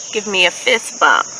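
ordinary delivery, nothing marked

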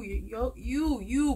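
ordinary delivery

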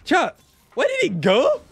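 high, incredulous plea to the viewers; baffled outcry. Real, not acted. Streamer asking chat where it went, baffled high cry. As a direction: let the pitch leap up in disbelief.

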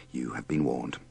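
ordinary talk

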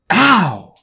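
sudden very loud high ouch; pained yelp. A narrator's reading. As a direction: cry out from a jarring jaw. Clay bites dragon, jaw hurts.

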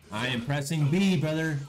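exasperated. Real, not acted. Frustrated gamer, button not working.

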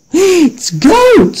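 high, squeaky disbelief; shocked little goat. A narrator's reading. Little goat hearing about the goat-eating troll, shocked and high. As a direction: jump up in pitch, aghast. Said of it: "said the little one".

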